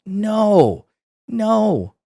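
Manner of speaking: high, drawn-out no; emphatic denial. Real, not acted. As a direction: answer your own question with long high notes. Answering own rhetorical question.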